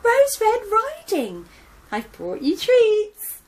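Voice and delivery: put-on high, eager little girl; chirpy young girl greeting; bright girl voice. Cheerful girl voice in story.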